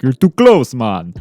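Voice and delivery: loud, high push-away; imitating a loud brush-off; mock rejection. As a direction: shove the words out loud and high. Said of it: "he's like".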